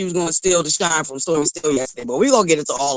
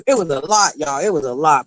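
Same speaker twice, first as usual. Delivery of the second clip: normal talking voice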